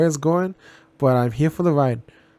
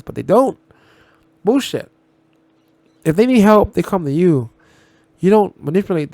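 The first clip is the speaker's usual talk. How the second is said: rising into a loud, higher indignant rant; indignant outburst; 4 semitones higher, 7 dB louder than usual. Real, not acted. Ranting to viewers about the therapist, loud and indignant. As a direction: snap back in real disgust at her methods.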